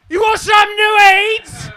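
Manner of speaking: high, loud yelled question; loud mock shout. Attendant shouting at a confused passenger, loud mock yell. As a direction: bellow it at the passenger. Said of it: "she was like".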